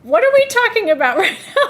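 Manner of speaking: high, loud, laughing mock question; laughing disbelief. Host reacting to the fish story, laughing. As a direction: ask it as if you can't believe your ears. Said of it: "And I'm like".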